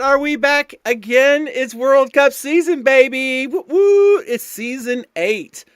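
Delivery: high, loud hype-man opening; excited shout. Real, not acted. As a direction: whoop it up to kick off the season. Hyped stream opening, season start.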